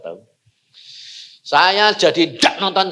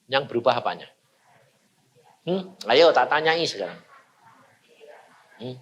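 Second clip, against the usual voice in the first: ordinary delivery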